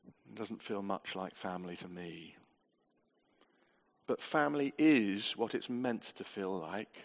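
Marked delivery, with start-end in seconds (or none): 0.1-2.4 low, soft imitation of a doubter; quiet private doubt. Preacher voicing a doubter in the congregation, quiet and low. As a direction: voice an unspoken thought, very softly.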